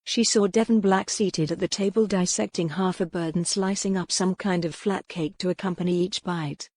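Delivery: ordinary delivery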